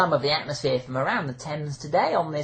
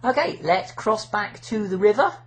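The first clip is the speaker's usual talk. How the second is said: normal talking voice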